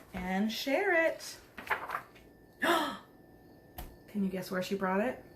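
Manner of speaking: usual speaking voice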